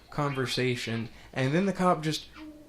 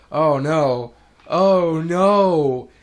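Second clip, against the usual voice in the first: louder, higher panicked oh no; mock panic; 3 semitones higher, 9 dB louder, much slower than usual. The other cop panics, hammed-up mock fear. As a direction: play a cop freaking out. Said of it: "he's like".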